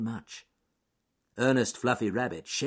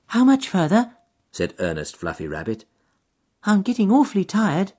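little rabbit; 12 semitones higher, 9 dB louder than usual. Acted. Rabbit voiced in story.

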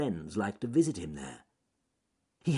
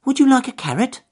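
little rabbit; 12 dB louder, faster than usual. Acted. Rabbit offers carrot.

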